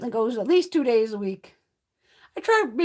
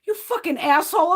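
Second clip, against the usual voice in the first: inner fury; 10 semitones higher, 7 dB louder than usual. Retold or imitated. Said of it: "My mother's in her head going".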